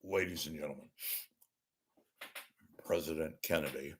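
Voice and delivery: hushed, grave anchorman; Walter Cronkite. Acted. Anchorman announcing the president's death, hushed and solemn. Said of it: "This is Walter Cronkite".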